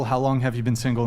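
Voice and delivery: usual speaking voice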